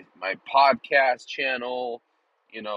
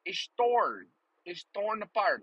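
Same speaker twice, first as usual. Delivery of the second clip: put-on Dutch-ish accent; 6 semitones higher than usual. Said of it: "Like I'm fucking Dutch or something".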